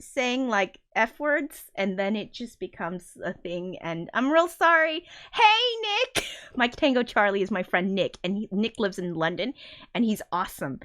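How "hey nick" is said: high, loud hello; calling out. Real, not acted. Calling out hello to Nick in chat, cheerful. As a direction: sing out a friend's name.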